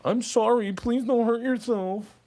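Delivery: high, drawn-out mock pleading; mocking fake apology. Parodying an apology to a troll, mocking and high. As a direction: whine it out high and slow, mockingly.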